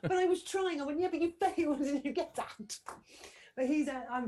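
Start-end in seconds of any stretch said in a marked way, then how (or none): 0.0-0.8 much higher, louder mock whine; hammed-up whiny husband; whiny protest. 1.0-2.8 higher, louder teasing through a laugh; playful laughing dismissal; laughing retort. Retold or imitated.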